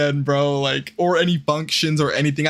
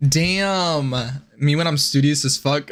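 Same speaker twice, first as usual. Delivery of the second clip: usual speaking voice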